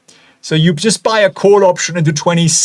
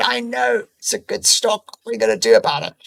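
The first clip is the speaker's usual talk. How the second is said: a hint of a weary sigh; lightly voiced resigned shrug; sighing Winston; 5 semitones higher, 5 dB quieter than usual. Winston sighs about the good stock, mild weary voice. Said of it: "Winston just sighed and said".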